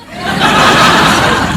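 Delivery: a short breathy laugh out loud; real laugh. Real, not acted. Comedian laughing at his own story, real laugh. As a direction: laugh at your own confession.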